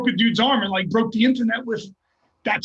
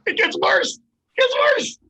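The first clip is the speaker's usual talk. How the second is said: incredulous squeal; 14 semitones higher than usual. Real, not acted. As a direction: voice shooting up very high. Breaking up over his own story.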